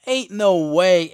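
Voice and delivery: second "Ain't no way" burst out slowly in protest; disbelieving shout. Retold or imitated. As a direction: drag out "Ain't no way" loudly. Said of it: "I was like".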